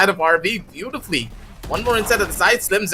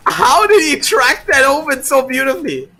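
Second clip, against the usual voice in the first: amazed; 7 semitones higher, 6 dB louder than usual. Real, not acted. Caster amazed by a shot.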